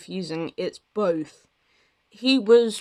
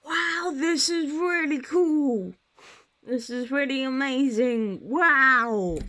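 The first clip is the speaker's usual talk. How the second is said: high, excited, gushing wow; 6 semitones higher than usual. Retold or imitated. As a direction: gush like a thrilled new fan. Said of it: "my voice sounded fucking auto-tuned there".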